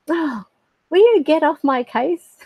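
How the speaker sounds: hammed-up exasperation at a nagging parent; exasperated retort. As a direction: imitate a fed-up child answering back. Child's exasperated retort, imitated.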